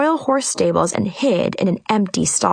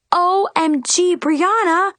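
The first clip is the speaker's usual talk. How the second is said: higher, thrilled squeal; excited; 8 semitones higher, slower than usual. A narrator's reading. Girl greeting her late fairy godmother, thrilled and higher. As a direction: greet her like a long-lost friend.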